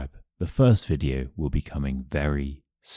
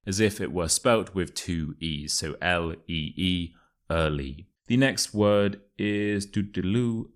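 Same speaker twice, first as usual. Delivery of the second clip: normal talking voice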